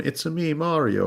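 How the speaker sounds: high-pitched game-character catchphrase; Mario. Retold or imitated. Quoting a little quip from a game, high cartoon Mario impression. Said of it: "little quips in a game where you're going".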